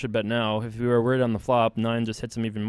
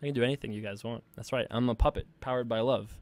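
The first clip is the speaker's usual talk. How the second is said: ordinary talk, nothing marked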